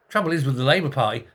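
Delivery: ordinary talk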